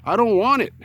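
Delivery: put-on high, insistent hillbilly old-timer. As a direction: refuse it like a stubborn old hillbilly. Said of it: "old man hillbilly voice".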